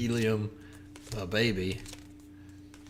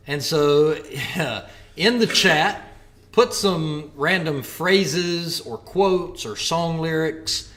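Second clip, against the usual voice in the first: normal talking voice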